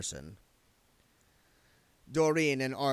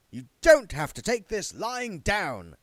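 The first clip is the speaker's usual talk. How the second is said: high, louder, pleased with himself; proud; 10 semitones higher, 8 dB louder than usual. A narrator's reading. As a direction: show off his own slogan. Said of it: "said Mister Shue proudly".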